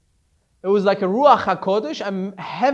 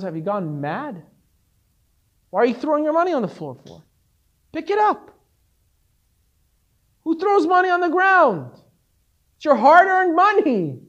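rising, louder disbelief; incredulous rebbe; 5 semitones higher, 3 dB louder than usual. Retold or imitated. Rebbe scolding the disciple for throwing money, incredulous.

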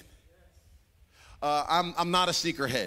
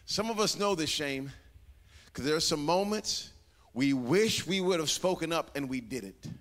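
usual speaking voice

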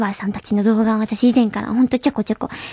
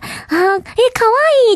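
put-on higher-pitched Osaka-accented coo; Kansai-accented cute gush; 10 semitones higher than usual. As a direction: imitate an admirer's Kansai-accented "kawaii". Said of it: "訛り声で". (関西弁混じりの「かわいい」の物まね)

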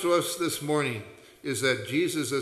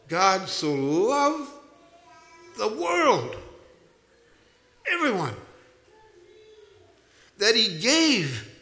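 slowly, each word of God so loved weighted and drawn out; a pastor savouring the verse, slow and higher; slow emphatic recitation; 7 semitones higher, 4 dB louder, slower than usual. Real, not acted. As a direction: lean on every phrase of the verse.

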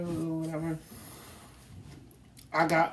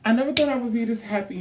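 higher, louder and fast, gushing; excited retake; 6 semitones higher, 4 dB louder, faster than usual. Real, not acted. Vlogger redoing her happy line to viewers, excited. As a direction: burst out with joy. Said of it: "Hold on, my bad, y'all".

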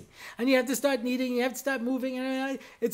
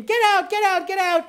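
higher, louder mock shout; retold screaming; 9 semitones higher, 4 dB louder than usual. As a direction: yell it like a panicked crowd. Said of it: "everybody starts screaming".

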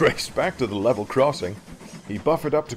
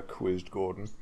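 usual speaking voice

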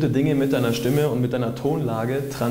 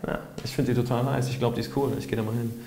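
casual, assured; 4 dB quieter than usual. Shown as a demonstration. Approaching someone he finds nice, casual and relaxed. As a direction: think it and say it with no fuss. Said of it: "in diesem Tonfall".

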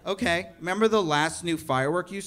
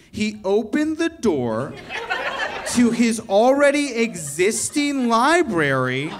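slow sarcastic; 6 semitones higher, 3 dB louder, slower than usual. Real, not acted. Mocking the library gift.

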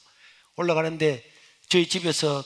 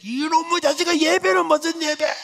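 much higher, slow indignant outburst; furious retort; 13 semitones higher, 5 dB louder than usual. Retold or imitated. Mother scorning her son's worship, high furious outburst. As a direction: spit it back at him in disbelief.

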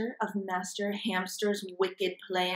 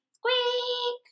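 tiny squeaky hamster noise; hamster squeak. Acted. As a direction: squeak it out much higher.

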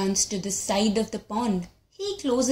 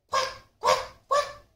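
very high quacking; duck quack. Acted.

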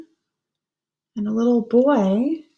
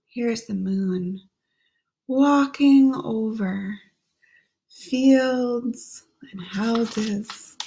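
ordinary delivery

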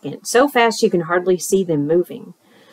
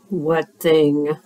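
low gruff bear voice; a touch grumpy and lower; 3 semitones lower than usual. Acted. Bear's voice.

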